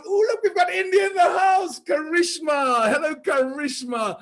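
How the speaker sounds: bright mock Indian accent greeting India. Host greets India in a playful Indian accent. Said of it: "I hope you don't mind my Indian accent".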